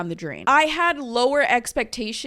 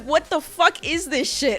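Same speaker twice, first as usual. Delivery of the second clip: higher, slow, drawn-out outrage; retold disbelief; 6 semitones higher, 3 dB louder than usual. Kid watching the bad movie, retold appalled disbelief. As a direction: act out a baffled, disgusted viewer.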